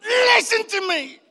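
high, loud, drawn-out screech; furious wife. Retold or imitated. Enraged wife to husband in the car, imitated furious scream. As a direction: scream slowly at him, pitch way up. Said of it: "angry that the vein in their neck".

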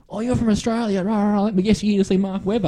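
high-pitched acted greeting from a British driver; Jenson Button. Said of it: "walk past at the time Jenson Button".

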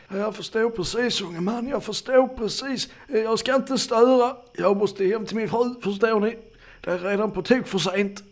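put-on high, apologetic elderly voice; flustered old man. Old man leaving for home to his wife, flustered. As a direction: excuse yourself in a high, old voice.

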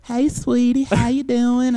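high, loud sugary southern lady; mock director sweetly greeting the student.

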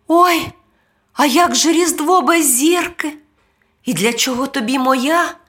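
put-on high child voice; high-pitched, questioning boy; worried boy. A narrator's reading. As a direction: ask as a puzzled little boy.

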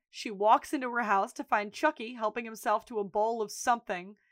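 normal talking voice

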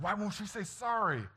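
high-pitched, exasperated appeal; mock cry of parental despair; retold exasperation. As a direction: plead in hammed-up frustration. Exasperated appeal to his wife.

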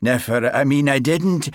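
put-on flustered Highland accent. A narrator's reading. Sergeant caught staring at his constable, flustered Highland accent. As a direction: stumble over it in a thick Highland accent. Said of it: "His Highland accent always grew more pronounced when he was flustered".

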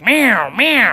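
high, wailing cat impression; imitated insistent meows; cat meowing. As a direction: yowl like a cat in a carrier. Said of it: "she's like".

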